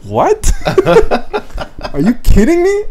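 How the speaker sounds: high-pitched, laughing disbelief; loud, squeaky mock disbelief; incredulous. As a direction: act out outraged amazement, voice leaping up. Acted disbelief at pilot's announcement.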